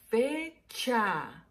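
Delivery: "fecha" stretched as fee-chaa; drawn-out syllables. Shown as a demonstration. Teacher introducing a word with fe, "fecha" in long syllables. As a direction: say "fecha" slowly, each syllable held. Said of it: "dos sílabas".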